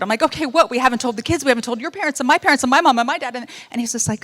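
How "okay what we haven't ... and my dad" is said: higher, louder and fast, spilling out in a rush; exploding at husband. Retold or imitated. Wife exploding at her husband over the pregnancy news, fast and loud. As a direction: let it burst out all at once, rattling off the list. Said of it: "And here I just explode".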